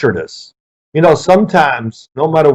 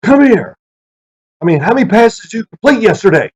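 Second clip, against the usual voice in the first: imitated coach, much higher and louder; interrogating coach; 7 semitones higher, 3 dB louder than usual. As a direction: grill him like a tough coach. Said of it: "I can hear the tone of this".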